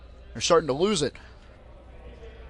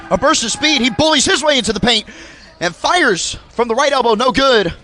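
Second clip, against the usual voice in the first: excited call; 7 semitones higher, 7 dB louder than usual. Acted. Drive and missed shot.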